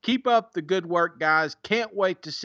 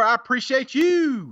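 showy sign-off to a reviewer; catchphrase flourish; 6 semitones higher, 3 dB louder than usual. Real, not acted. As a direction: belt out your catchphrase, pitched way up then falling. Same catchphrase to next reviewer.

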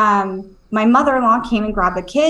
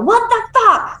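high-pitched re-enacted yell; retold yell; 12 semitones higher than usual. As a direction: yell it high, without full volume.

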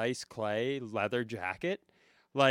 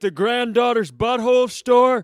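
put-on high, loud caricature of an outraged old man; old man character; 10 semitones higher, 11 dB louder than usual. Co-host joins the bit as grandfather heckling an outfit, old man. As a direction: holler it as a cartoonish old man. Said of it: "It's an old man who".

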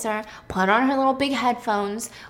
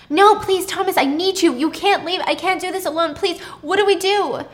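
high, distressed begging; desperate pleading; 9 semitones higher, 5 dB louder than usual. Acted. Pippa begging Thomas to stay, high and desperate. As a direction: beg them not to leave you.

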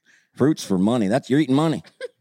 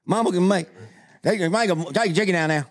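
mock Southern voice; 11 semitones higher than usual. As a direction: brag about Mama's cooking like a local. Louisiana character bit.

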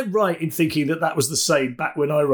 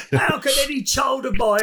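mock outrage; 10 semitones higher than usual. Mock outraged parent about lost keys.

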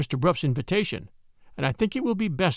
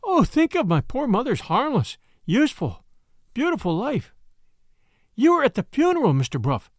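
anguished, pitched-up horror; horrified outburst; 6 semitones higher than usual. Acted. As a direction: recoil in horror and beg to leave. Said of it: "with a scream of horror".

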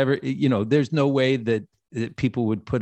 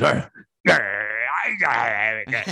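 put-on drunken growl; much slower than usual. Drunk Reed growling again.

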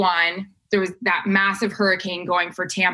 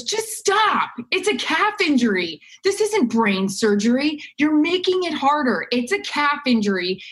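higher, brisk no-nonsense fatherly pep talk; exasperated dad; 5 semitones higher than usual. Retold or imitated. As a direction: snap her out of it, a tough-love dad. Dad scolds nervous daughter on phone.

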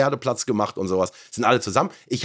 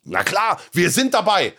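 retold sudden resolve, pitched up; rally the troops, higher and keener; rousing rally cry; 6 semitones higher, slower than usual. Streamer retelling how the chat won him over, rousing.

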